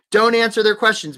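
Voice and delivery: punchy and forceful; emphatic repeat. Real, not acted. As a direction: drive the advice home.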